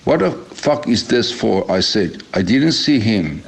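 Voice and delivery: usual speaking voice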